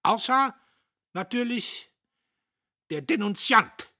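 sehr hoch und laut gellend; geschrien. A narrator's reading. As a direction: mit letzter Wut die Beschuldigung hinausschreien. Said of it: "schrie er noch auf der Treppe". (der Major schreit Diederich von der Treppe nach)

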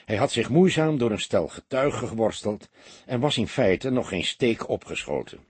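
usual speaking voice

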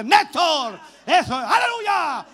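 loud, high shout; grito fuerte. Shown as a demonstration. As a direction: shout it out hard, stretching the end. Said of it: "si tenía que gritar fuerte". (grito fuerte y jubiloso)

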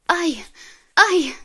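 high loud cries of pain; sharp pained yelps; yelping in pain. A narrator's reading. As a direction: scream out as the hand breaks. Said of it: "зойкнула я".

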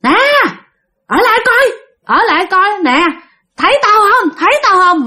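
high, insistent acted call from behind; repeated high-pitched calls of a pursuing ghost; ghost calling after. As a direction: call after someone fleeing, pitched well up. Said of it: "nghe cái tiếng bà kêu ở đằng sau".

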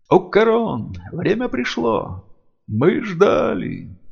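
high, slow otherworldly chant; booming spirit voice. Acted. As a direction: call out like ancient spirits. Ancient dead spirits call out.

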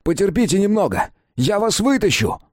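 man reassuring someone trapped, high shout. A narrator's reading. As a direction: shout down to keep them hoping.

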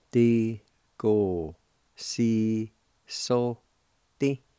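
very slow, every syllable drawn out and separated. Shown as a demonstration. Teacher sounding out a Cherokee word for learners, very slowly. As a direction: spell the word out in sound, one syllable at a time. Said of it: "it's pronounced like this".

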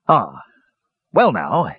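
hesitant stalling; evasive mutter. A narrator's reading. Dwarf captain dodging an awkward question, hesitant mutter. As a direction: stall for time sheepishly. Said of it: "he muttered".

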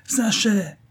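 high-pitched hail; calling out. A narrator's reading. A friend hails the driver from behind, calling out. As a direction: call after a friend from afar. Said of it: "cria une voix".

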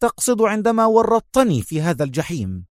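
high-pitched, heated accusation; sharp retort. A narrator's reading. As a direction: throw it back at him sharply. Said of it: "قال رأفت في حدة".

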